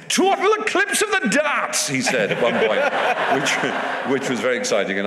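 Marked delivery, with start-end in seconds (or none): 0.1-1.9 very high, loud Geordie darts commentator; Sid Waddell. Acted. Asked what the darts commentator sounds like, high shrieking Geordie impression. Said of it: "what he sounds like".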